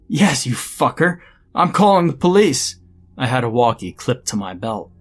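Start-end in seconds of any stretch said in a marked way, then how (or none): 0.1-2.8 loud, high, angry threat; furious outburst. A narrator's reading. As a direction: yell it at the culprit in outrage. Janitor's furious quarrel with professor.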